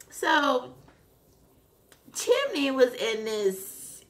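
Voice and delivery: normal talking voice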